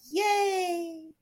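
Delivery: high sarcastic fake cheer; a mock-cheerful yay. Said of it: "Like, sarcastically".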